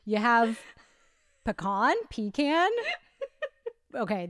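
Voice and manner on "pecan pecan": pecan as puh-KAHN, then pecan as PEE-can; both pronunciations. Shown as a demonstration. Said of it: "Not even both, multiple ways".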